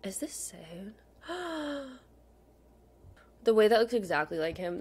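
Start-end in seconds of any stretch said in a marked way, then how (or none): 1.3-1.9 sharp, high intake of breath; real gasp of surprise. Real, not acted. Collector spotting a lookalike card, surprised gasp. Spots a card that looks like him.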